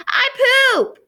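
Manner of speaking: put-on small child proudly announcing; toddler announcement. A narrator's reading. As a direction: blurt it out like a toddler. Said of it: "said Boots".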